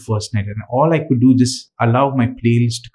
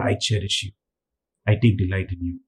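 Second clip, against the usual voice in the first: drops much softer and lower, intimate and gentle; tender whisper-soft; 4 semitones lower, 7 dB quieter than usual. Pretend. God tells his beloved son he delights in him, hushed and tender. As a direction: say it close and softly, like a secret of love.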